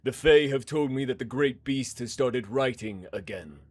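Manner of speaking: menacing hard man. Acted. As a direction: let the menace sit under calm words. Man threatens the priest.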